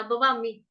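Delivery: बवामी with plain b, no puff of breath; unaspirated b. Shown as a demonstration. Teacher shows the wrong reading, बवामी with unaspirated b. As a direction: misread it as बवामी, with the ba of बकरी. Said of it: "इसको ऐसे नहीं पढ़ना".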